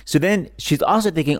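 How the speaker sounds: normal talking voice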